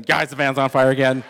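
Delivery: loud retold shout to friends; hollering a warning in a story; acted alarm call.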